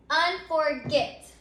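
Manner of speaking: "Unforget" drawn out slowly, syllable by syllable; sounded out slowly. Shown as a demonstration. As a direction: say "Unforget" slowly and distinctly for the class. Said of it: "let's say the word unforget out loud".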